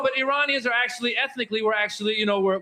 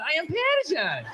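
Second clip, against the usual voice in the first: higher, friendly mock Persian accent with a smile; smiling Persian accent; 7 semitones higher than usual. Shown as a demonstration. Comedian shows Iranians smiling saying they're Persian, Persian accent. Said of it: "sounds nicer and friendlier".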